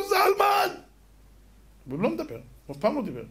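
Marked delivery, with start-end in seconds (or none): 0.1-0.8 high, loud call of reunion; old man cries out on finding his lost brother; joyful cry of a name. Acted.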